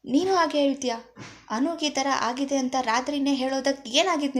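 angry outburst. Acted. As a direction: snap at everyone to get out. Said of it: "ಆಗ ಕೋಪಗೊಂಡ ಇಂಚರ".

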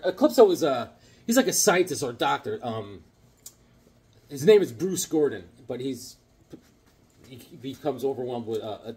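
ordinary delivery

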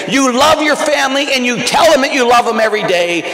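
loud, high, impassioned preaching; rousing sermon finale; preaching at full force. Acted. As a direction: build to a fervent climax, calling out. Sermon climax.

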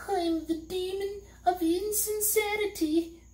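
a high tearful little creature; sobbing demon. A narrator's reading. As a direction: sob it out, caught. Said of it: "he sobbed".

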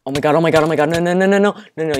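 loud, high rapid-fire cries, climbing; panicked yelling. Real, not acted. Player in sudden danger, panicked yelling. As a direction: yell in rising panic.

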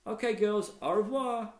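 a hint of a cheerful boy listing snacks; casual boy, chatty and pleased; lightly voiced schoolboy Mac. A narrator's reading. Mac reeling off his breakfast to Lisa, lightly voiced cheerful boy.

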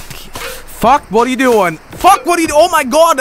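real gamer frustration; exasperated yelling. Real, not acted. Player botches the play.

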